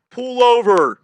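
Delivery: booming, high shouted command; megaphone cop shout. Retold or imitated. Cop ordering a fleeing car over, megaphone shout. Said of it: "gets on the fucking megaphone".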